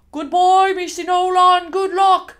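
loud, high hearty shout; calling out farewell. Acted. Lodge keeper seeing Miss Enola off, high loud call. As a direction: holler it as the carriage passes.

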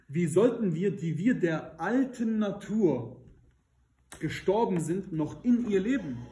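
höher und gedehnt, mit Kraft; predigende Lesung. Acted. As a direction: den Vers langsam und eindringlich verkünden. Liest Römerbrief predigend. (Predigerlesung, höher und sehr langsam)

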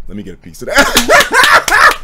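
slow, high quote that dissolves in laughter; slow high laughing quote. Retold or imitated. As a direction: quote him through a laugh. Quoting friend, then laughing.

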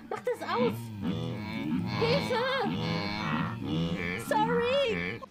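high, loud panicked cries; panicked shout. Real, not acted. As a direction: yelp for help in panic.

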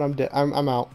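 ordinary delivery

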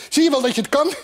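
high, excited puffing bird; loud, high, slightly winded cheer; panting. A narrator's reading. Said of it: "hijgde het".

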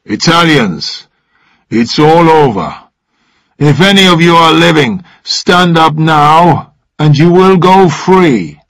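lightly voiced soldier calling out; firm squad orders. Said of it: "the Croatian soldier's voice".

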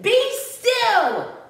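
high, ringing command. Acted. Max tames the wild things, commanding. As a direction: order monsters to freeze, voice pitched high.

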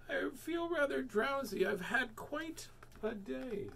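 high, then sinking soft and slow into a sleepy drawl; sleepy townsfolk; drowsy voice. Acted. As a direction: sound like you're nodding off mid-sentence. Drowsy people quoted in story.

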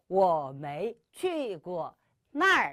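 very slow but bouncy and light; 경쾌하게 천천히 읽기; slow and lively. Shown as a demonstration. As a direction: read it slowly with a cheerful swing. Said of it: "한 번 더".

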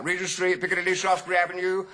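high, rapid conductor's call. Retold or imitated. Bus conductor to passengers, calling out. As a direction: rattle off the stops loudly. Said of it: "he called out".

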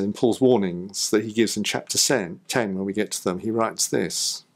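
normal talking voice

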